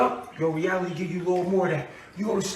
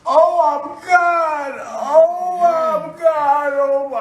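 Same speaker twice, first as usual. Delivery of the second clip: slow, high, loud wail of revelation; ecstatic crying shout; 6 semitones higher, 7 dB louder, much slower than usual. Acted. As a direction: cry out as if struck by a revelation.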